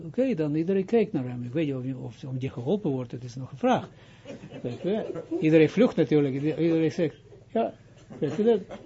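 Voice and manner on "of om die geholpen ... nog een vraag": ordinary talk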